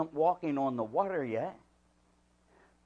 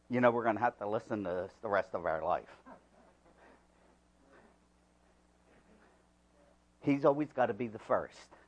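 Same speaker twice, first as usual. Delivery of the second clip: lower, quicker mock mutter of the other disciples; grumbling disciples; 6 semitones lower, 5 dB quieter, faster than usual. Disciples in the boat, mock complaining behind Peter. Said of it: "heard the board meeting in the boat behind him".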